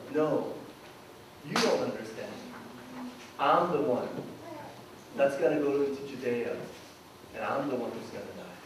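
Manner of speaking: low, hushed, grave answer; softly spoken, solemn explanation; quiet correction. Retold or imitated. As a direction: drop low and soft to set them straight. Jesus quietly answers their sarcasm.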